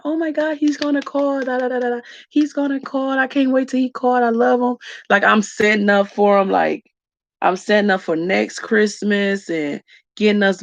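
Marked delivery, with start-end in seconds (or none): 0.0-4.8 much higher, giddy lovestruck gush; giddy about husband. Retold or imitated. Imitating herself waiting for husband's call, giddy and high. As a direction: gush like a girl with a crush. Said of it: "I'm like".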